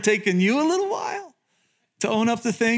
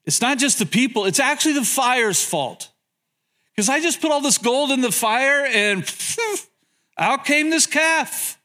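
high-pitched, implausible excuse, drawing out the last words; mock innocence, pitched up; excuse-making Aaron; 6 semitones higher than usual. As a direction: make a lame excuse in a higher voice, hammed up. Aaron making absurd excuses to Moses.

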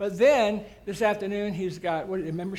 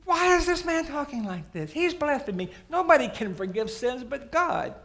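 indignant teachers, pitched high; mock outrage at a blasphemer; hammed-up indignant scribes, higher; outraged; 8 semitones higher, 4 dB louder than usual. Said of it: "they blow a gasket".

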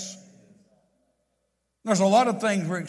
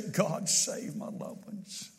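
hushed, slow pleading prayer to God; softly pleading prayer, almost a whisper; fervent whispered prayer; 8 dB quieter, slower than usual. Shown as a demonstration. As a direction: pray it under your breath, slowly and earnestly.

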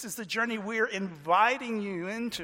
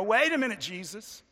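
puzzled objection; 3 semitones higher, 7 dB louder than usual. Acted. Listener raising a hand to question Jesus, puzzled protest. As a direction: interrupt to challenge, hammering each point. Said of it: "We want to raise our hand and go".

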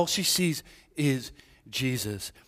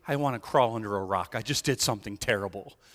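ashamed; 6 semitones lower, 3 dB quieter than usual. Acted. Voicing the ashamed woman's thought.

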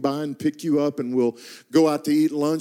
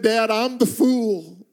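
higher, slow tearful plea; 7 semitones higher, slower than usual. Retold or imitated. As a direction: retell his son's tearful plea. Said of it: "through tears".

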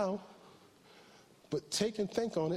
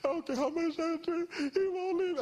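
crying caller; 11 semitones higher than usual. Retold or imitated. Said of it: "He said".

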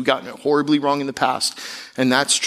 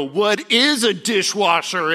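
a higher, slower, mocking "what is a dishwasher?"; mock blow-off; 7 semitones higher, slower than usual. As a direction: play dumb to dodge the chore with a higher, slower put-on voice. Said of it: "I looked at her and go".

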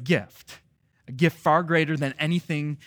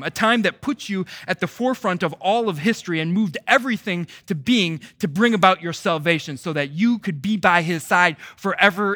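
rising, louder pulpit cry; full-force preaching; 4 semitones higher, 6 dB louder than usual. Acted. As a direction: preach it out with mounting fervor.

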